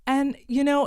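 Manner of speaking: normal talking voice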